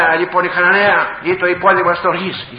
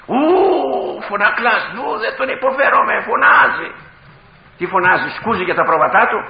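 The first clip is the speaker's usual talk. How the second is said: high-pitched mocking complaint about a loudmouth; grumbling objectors; 7 semitones higher, 5 dB louder than usual. Odd types complain about the shouting preacher, mocking grumble. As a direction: mimic people who can't stand the preacher. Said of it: "υπάρχουν μερικοί παράξενοι τύποι".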